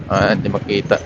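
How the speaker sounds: ordinary delivery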